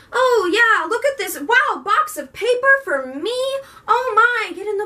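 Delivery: high, sing-song delighted voice; imitating Winter cooing over a box; dreamy Winter. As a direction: play her as a sweet oddball girl. Voicing Winter finding Cress.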